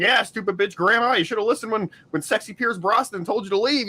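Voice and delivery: mock the dead grandma; jokingly jeering at a movie death; mocking joke. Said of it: "joking, like".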